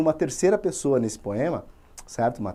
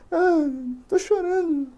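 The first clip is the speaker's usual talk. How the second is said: mock crying; 13 semitones higher, slower than usual. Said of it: "ele dá uma chorada".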